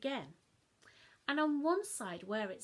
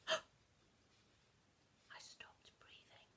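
slow, much softer gasped whisper; frozen whisper; 12 dB quieter, much slower than usual. A narrator's reading. Child caught out by Mum, breathless whisper. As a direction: hold your breath in horror. Shock at mention of cake.

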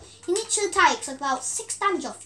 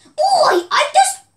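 a sharp loud higher yell of oi; startled yell; sudden shout; 12 semitones higher, 10 dB louder, much slower than usual. Real, not acted. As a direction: shout it out in alarm. Startled by animal.

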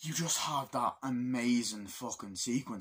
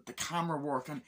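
usual speaking voice

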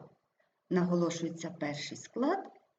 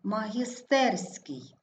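Магістерський slowly, stressed магіс-ТЕР-ський; stress on third syllable; slower than usual. Shown as a demonstration. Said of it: "Також наголошений третій склад". (Магістерський повільно, з наголосом на ТЕР)

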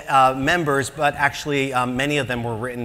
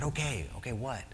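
nervous; 3 semitones lower, 12 dB quieter than usual. Retold or imitated. Nervous reply, hushed.

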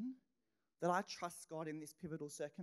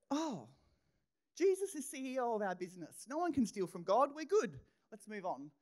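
bright relief; 5 semitones higher, 5 dB louder than usual. Retold or imitated. Retold resolve after crisis.

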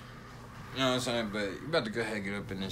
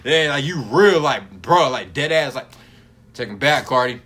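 emphatic plea; 3 semitones higher, 11 dB louder than usual. Pretend. Pleading to [name] again, emphatic.

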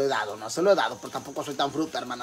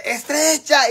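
put-on high, loud shout of a drunk; imitated drunken yell; 9 semitones higher, 6 dB louder, much slower than usual. Drunk man shouting at her, imitated yell. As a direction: mimic a drunk hollering at the door. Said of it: "Y gritándome".

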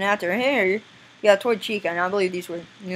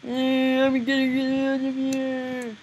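high, slow drawn-out whine; put-on character voice; 6 semitones higher, slower than usual. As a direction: drag it out in a silly cartoon voice.

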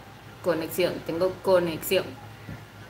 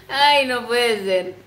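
amused disbelief; 4 semitones higher, 6 dB louder, much slower than usual. Real, not acted. Laughing reaction to comment.

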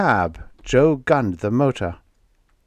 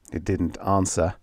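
usual speaking voice

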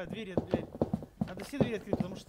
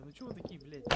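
normal talking voice

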